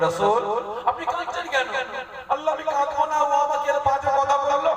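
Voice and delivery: high, pleading complaint to God; voiced holy dialogue. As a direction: plead up high like a hurt child. Allah and Prophet voiced dialogue. (উঁচু স্বরে অভিযোগ)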